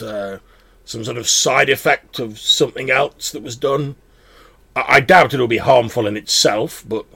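a proud magic blade speaking; talking sword voice. Acted. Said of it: "the voice sort of pauses".

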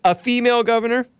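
a sarcastic, hammed-up gasp of a question; mock disbelief. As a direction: shoot the voice way up in mock shock. Mock outrage at female governor.

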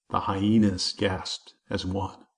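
usual speaking voice

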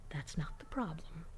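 hushed unvoiced whisper. To Joe about the job, a flat hushed whisper. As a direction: say it under your breath, barely audible. Quiet retort to roommate.

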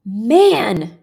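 frustrated yell. A narrator's reading. One guy losing patience with his friend, frustrated yell. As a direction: yell it out, exasperated. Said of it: "one guy yelled in frustration".

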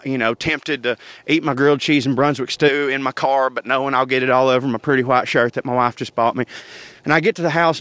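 usual speaking voice

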